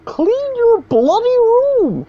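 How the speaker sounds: very high, slow, drawn-out squeak; mock him in a strained high voice; hammed-up high-pitched lecturer; Jordan Peterson impression. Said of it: "high-pitched voice".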